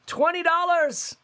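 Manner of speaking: high, thrilled cry of twenty dollars; real delight, pitch leaps up; excited exclaim. Real, not acted. Announcing Jay's twenty-dollar donation to viewers, real high excited cry.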